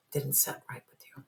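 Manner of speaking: soft whispered repeat; whispered echo. Real, not acted. Reader echoes her point in a whisper.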